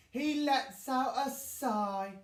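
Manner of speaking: ordinary delivery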